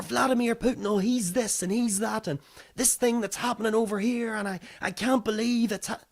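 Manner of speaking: high-pitched imitated ranting; mock screaming. Public reacting to headline, mock hysterical ranting. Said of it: "screaming and shouting".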